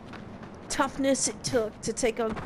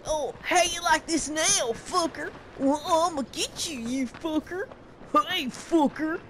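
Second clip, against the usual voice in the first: higher, hammed-up hillbilly thug taunt; generic hillbilly bad guy; 6 semitones higher than usual.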